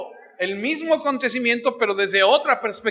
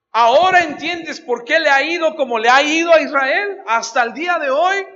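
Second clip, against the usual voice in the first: louder, higher rhetorical challenge; preaching emphasis; 6 semitones higher, 5 dB louder than usual. Real, not acted. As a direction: press the question on the congregation. Preacher drives the point home.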